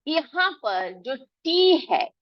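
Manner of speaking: ordinary talk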